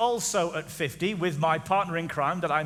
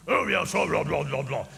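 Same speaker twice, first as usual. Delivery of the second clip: mock impression; 4 semitones lower than usual. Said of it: "I'm gonna do an impression now".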